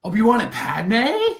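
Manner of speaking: loud, high-pitched disbelief, rising at the end; incredulous. Real, not acted. Co-host hears a wild fan theory, incredulous. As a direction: blurt it back as a stunned question.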